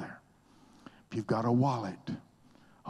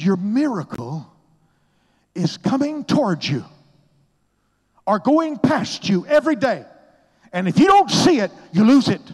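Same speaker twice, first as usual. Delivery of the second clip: much higher and louder, ringing out; emphatic repeat; 12 semitones higher, 15 dB louder than usual. Retold or imitated. Oral Roberts repeats his words, booming and high. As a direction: proclaim it like a mentor insisting. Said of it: "Say that again".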